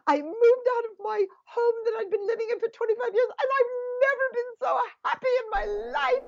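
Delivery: very high, gushing imitation of an English accent. A woman telling what happened to her this year, imitated in a high English accent. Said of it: "She had this beautiful English accent".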